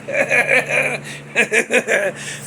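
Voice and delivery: tail end of a laughing fit; real giggling. Real, not acted. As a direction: keep giggling, winding down. Laughing at son's reaction.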